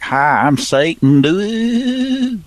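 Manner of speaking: lightly voiced devil, slow; hammy devil greeting; Satan voice. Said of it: "We could use the Satan voice".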